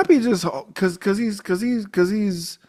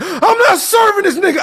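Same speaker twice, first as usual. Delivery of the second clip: shrill-pitched mocking of Ralph, much louder; Ralph's high mock voice; 6 dB louder than usual. Retelling the diner scene, the owner refuses in a high loud mock voice. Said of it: "Ralph is like".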